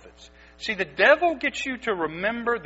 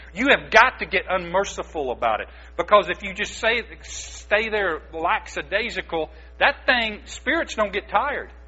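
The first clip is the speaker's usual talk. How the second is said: ordinary talk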